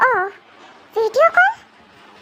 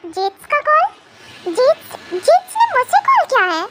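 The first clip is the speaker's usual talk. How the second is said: rising surprise, pitch climbing; startled that he is calling; surprised; 6 semitones higher than usual. Acted. Surprised at caller's call. (हैरानी से)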